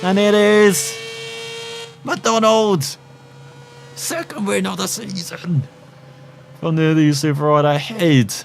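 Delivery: high, urgent play-by-play; excited race commentary. Acted. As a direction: call the race live, pitched high and building to the win.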